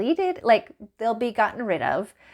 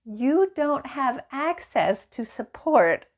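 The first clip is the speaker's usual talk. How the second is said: higher, slow bot voice; slow, high, mocking bot refusal; mock chatbot; 4 semitones higher, slower than usual. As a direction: deliver the paywall line smugly. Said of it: "it said".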